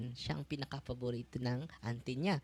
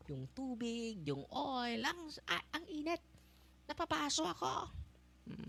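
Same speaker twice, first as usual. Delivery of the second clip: higher, softer pained demon voice; mock demon cry; 11 semitones higher, 4 dB quieter than usual. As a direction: lightly act the demon recoiling from the heat. Demon burned by holy water. (bahagyang ginayang hiyaw ng demonyo)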